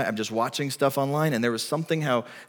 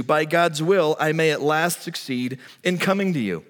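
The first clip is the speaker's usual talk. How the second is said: preaching scripture; 4 semitones higher than usual. Acted. Preacher reading Paul's letter to the Romans, ringing and raised. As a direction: proclaim Paul's words with full force.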